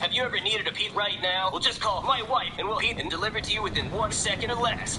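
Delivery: cheesy commercial pitch; announcer parodying a commercial; mock ad read. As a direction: sell it like a TV ad.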